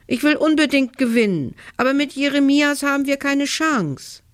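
high-pitched, pleading objection; heated protest. A narrator's reading. Boy defends himself to his mother about winning, protesting.

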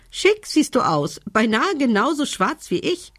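high-pitched, amused raven tease; giggling raven. A narrator's reading. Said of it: "kicherte Doktor Krehi". (kichernde, hohe Rabenstimme)

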